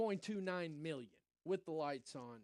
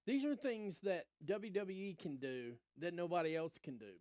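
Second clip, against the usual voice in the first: ordinary talk, nothing marked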